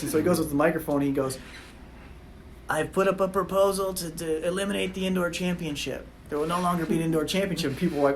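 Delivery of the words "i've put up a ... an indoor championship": put-on small nasally older man's voice, pitched up; higher, thin nasal impersonation of an elderly speaker; Pete Farwell. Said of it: "very, very small and, like, nasally voice".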